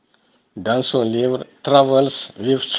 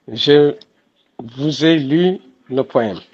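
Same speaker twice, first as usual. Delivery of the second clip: ordinary talk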